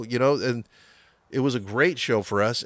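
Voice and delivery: ordinary delivery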